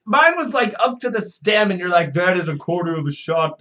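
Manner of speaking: loud, high outburst easing off; indignant protest. Real, not acted. Player protests friend's huge pour of whiskey, indignant outburst. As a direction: exclaim in outrage, then settle slightly.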